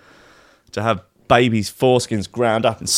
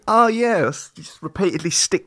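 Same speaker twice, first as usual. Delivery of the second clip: mock cheerful; 6 semitones higher than usual.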